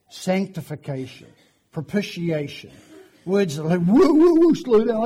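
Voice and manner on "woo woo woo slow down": high, loud whoa-stop interruption; mock alarm. Preacher playing a listener swamped by doctrinal words, mock alarm. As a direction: break in as if swamped by big words. Acts overwhelmed listener halting him.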